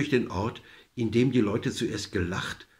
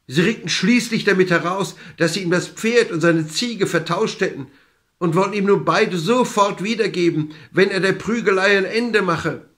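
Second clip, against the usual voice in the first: higher, louder storytelling at the climax; urgent, raised voice keeping the beating going; agitated narration; 6 semitones higher, 5 dB louder than usual. A narrator's reading. Villagers beg the man to stop the cudgel, agitated narration.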